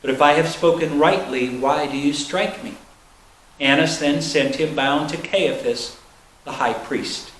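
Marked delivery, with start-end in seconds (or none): none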